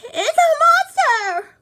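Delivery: squealing scared dog; terrified dog cry. Acted. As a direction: shriek in fright. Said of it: "cried the dog".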